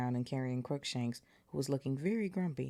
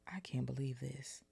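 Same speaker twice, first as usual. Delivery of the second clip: breathless whisper, almost voiceless; muttered whisper; 6 dB quieter than usual. Acted. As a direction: hush it in disbelief. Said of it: "Harry muttered".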